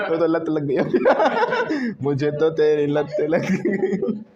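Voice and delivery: quoting the film line through laughter; sung-out movie line, laughing. Real, not acted. Teacher laughing while quoting a movie line.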